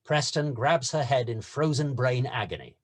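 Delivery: usual speaking voice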